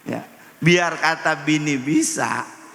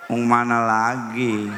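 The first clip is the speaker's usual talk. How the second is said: slurred wife; 6 semitones lower, slower than usual. Retold or imitated. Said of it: "Kata istri saya".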